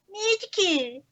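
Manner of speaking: high, derpy squeal; goofy high-pitched derp voice. Shown as a demonstration. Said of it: "say derp at one point".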